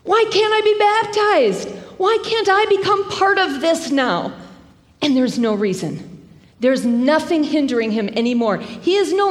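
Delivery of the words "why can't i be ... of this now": eager outcry. Retold or imitated. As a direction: cry out with eager longing. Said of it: "So what does he say?".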